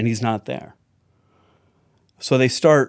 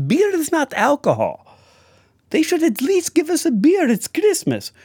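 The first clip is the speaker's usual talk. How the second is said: put-on high, indignant old grandma voice; 4 dB louder than usual. Grandma protesting to Bela there's no beer, high and indignant. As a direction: play the outraged grandmother, pitched up.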